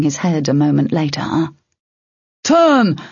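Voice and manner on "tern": high warning shout. A narrator's reading. Hessler shouting to Tern as trackers arrive, shouted. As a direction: yell a name across a fight. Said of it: "Hessler shouted".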